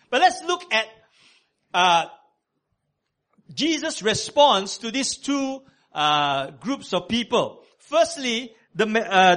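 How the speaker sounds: loud, high preaching at full force; full-voiced sermon delivery. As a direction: preach it out to the congregation.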